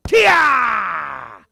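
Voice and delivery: long shouted "Kia!" falling in pitch. Shown as a demonstration. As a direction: yell "Kia!" with full breath. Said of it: "ready, go".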